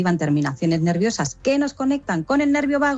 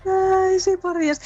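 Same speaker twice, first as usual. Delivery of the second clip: delighted; 13 semitones higher, slower than usual. Real, not acted. Exaggerated delighted agreement.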